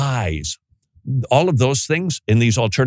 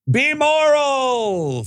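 high, very slow drawn-out call; called-out cry; much slower than usual. Acted. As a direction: stretch it out while running off a pier. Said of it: "goes running off the pier".

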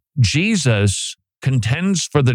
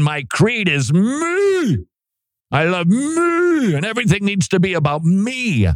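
mock self-adoration; 9 semitones higher than usual. Preacher parodies the creed of self, mock self-adoration. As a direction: gush about yourself, pitch soaring on "me".

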